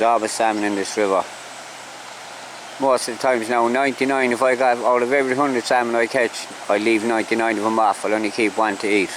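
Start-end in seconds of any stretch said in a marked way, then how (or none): none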